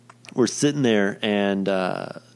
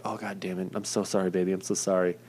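much softer, sheepish sorry; soft apology; 5 dB quieter than usual. Retold or imitated. Patient apologizing to terrified girlfriend, softly. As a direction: murmur it gently to a frightened partner.

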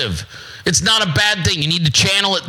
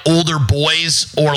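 ordinary talk

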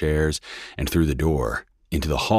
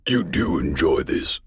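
raspy voice. Acted. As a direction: rasp it out, lung failing. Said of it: "His voice lowered to a raspy cough".

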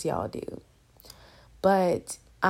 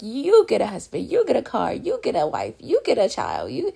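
lightly voiced Oprah, higher and louder, handing out prizes; Oprah giveaway; 3 semitones higher, 4 dB louder than usual. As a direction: hype it like a TV host giving away gifts. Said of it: "it's like Oprah, like".

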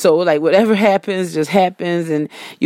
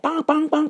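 high-pitched bong bong horn noise; horn imitation; 10 semitones higher than usual. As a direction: mimic a car horn honking. Imitating honking car horns.

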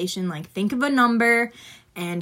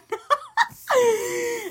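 high giggle. Real, not acted. Laugh after the protest.

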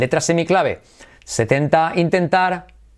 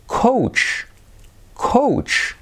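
high coach; 7 semitones higher than usual. Shown as a demonstration. Teacher models coach for learners, high-pitched. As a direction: coach said high and breathy, long oa. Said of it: "cuarenta y dos".

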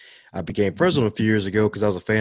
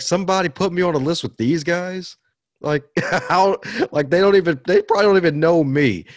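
high, louder disbelief with a laugh breaking in; incredulous; 7 semitones higher, 5 dB louder than usual. Retold or imitated. Podcaster recalls learning he made a top-ten list, incredulous. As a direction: retell being stunned, pitched up and insistent.

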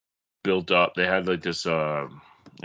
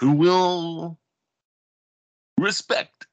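high, halting radio announcer; hammy vintage broadcaster; old radio voice; 8 semitones higher, 5 dB louder, slower than usual. Pretend. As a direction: punch each word like a contest promo. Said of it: "my radio voice".

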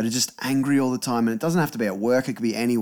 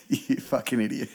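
trying not to laugh; 5 dB quieter than usual. Real, not acted. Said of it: "he's holding back laughter".

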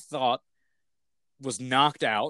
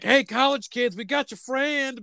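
high, slow dopey hillbilly; mock innocent yokel calling out; goofy hillbilly voice; 11 semitones higher than usual. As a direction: Dale calling to the college kids, high and goofy. Dale holding the body, quoted.

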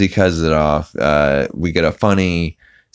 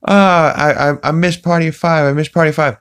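high, fast goofy whine; Peter Griffin impression; 10 semitones higher, 3 dB louder than usual. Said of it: "He's like".

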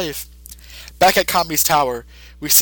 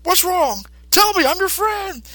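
much higher, slow pleading voice; pleading friend; slower than usual. Retold or imitated. Mister Satan pleading with a raging Boo, in a much higher, slow anxious voice. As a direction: beg him to let you help. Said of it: "Mister Satan's like".

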